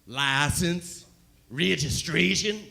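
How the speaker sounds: put-on slow drawled hillbilly accent, a light take. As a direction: play the Southern cop asking for papers. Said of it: "biggest hillbilly accent I'd ever heard".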